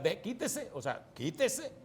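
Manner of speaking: retold order with authority; authoritative command. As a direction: order him aside, imitating your firm self. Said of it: "le digo con autoridad".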